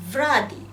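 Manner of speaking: ভ্রাদি loud, with a v between ব and ভ; model pronunciation. Shown as a demonstration. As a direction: demonstrate ভ্রাদি firmly, opening midway between b and bh. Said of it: "এভাবে বলবো যে".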